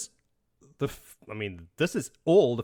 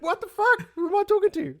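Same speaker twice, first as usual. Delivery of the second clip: high, loud, baffled shriek; Ty Lee shrieking at the stranger; incredulous Ty Lee; 5 dB louder than usual. Retold or imitated. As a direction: squeal it in total disbelief. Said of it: "she's just like".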